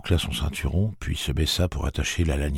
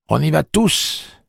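bold declaration; 13 semitones higher, 8 dB louder, slower than usual. Acted. Susanna declares.

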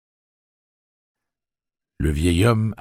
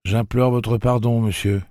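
put-on lightly raised boyish voice; a polite kid, higher and a bit louder; boy's voice; 7 semitones higher, 5 dB louder than usual. Jake absently greeting the old man, boyish.

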